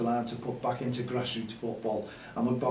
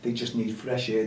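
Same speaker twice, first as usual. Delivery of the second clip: usual speaking voice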